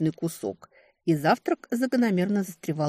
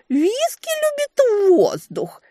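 very high, slow squeaky mimicry; mocking imitation; 5 dB louder, slower than usual. A narrator's reading. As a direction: ham up his voice, shrill and slow. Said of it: "передразнила она".

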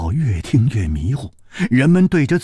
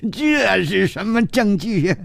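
high-pitched scoffing retort; scoffing character; 9 semitones higher than usual. Acted. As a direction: ask it high, dismissing the claim with scorn. Scoffs at the claimed evidence.

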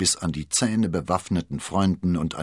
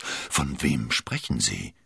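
slow, lower, stunned question; dismayed question; 5 semitones lower, slower than usual. A narrator's reading. Cardinal asks who the woman is, dismayed. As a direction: ask slowly, taken aback. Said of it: "rief der Kardinal bestürzt".

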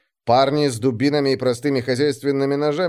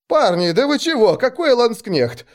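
high, squeaky protest; bewildered, squealing denial; frightened squeak; 11 semitones higher, 3 dB louder than usual. A narrator's reading. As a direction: play the scared simpleton, voice pitched up. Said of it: "запищал я".

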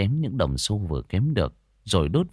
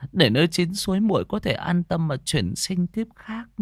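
murmured prayer; 7 semitones higher than usual. A narrator's reading. Wife prays at rival's grave, high mournful pleading. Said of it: "lầm rầm khấn vái".

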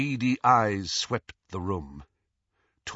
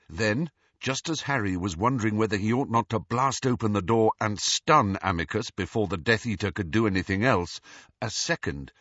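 plain reading voice

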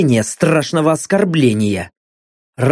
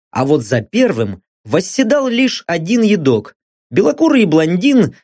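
plain reading voice

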